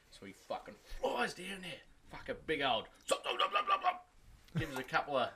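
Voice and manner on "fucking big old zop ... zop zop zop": zop sound effect. Acted. Joke, imitating Superman's swift moves.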